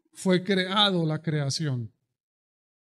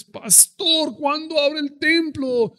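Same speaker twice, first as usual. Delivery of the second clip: much higher, louder nagging plea; impatient congregant; 10 semitones higher, 7 dB louder, slower than usual. Retold or imitated. Member nagging pastor about reopening, high whining. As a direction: whine at the pastor to open up. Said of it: "A los dos meses".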